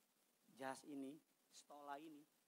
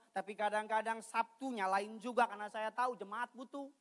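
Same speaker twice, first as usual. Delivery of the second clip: full-force preaching; 5 semitones higher, 11 dB louder than usual. Acted. Pastor preaching loudly to the congregation. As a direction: preach it out to the whole church.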